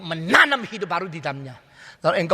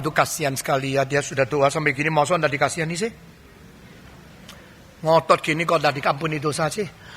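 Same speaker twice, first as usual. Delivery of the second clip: pitying God voice; 7 semitones lower, 4 dB quieter than usual. Retold or imitated. Preacher mocking a God who pities the crying sinner, low and soft. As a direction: lower and softer, a God sighing with pity. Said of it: "Lalu Tuhan bilang".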